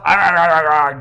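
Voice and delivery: loud barking arr arr; loud mock barks. A narrator's reading. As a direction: bark like a seal, loud and playful. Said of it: "Joe bucks, barks".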